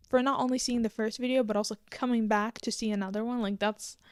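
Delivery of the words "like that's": usual speaking voice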